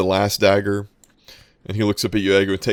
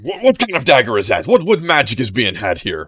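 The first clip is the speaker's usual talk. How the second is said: outraged disbelief; 5 semitones higher, 6 dB louder than usual. Acted. Rival outraged at magic dagger.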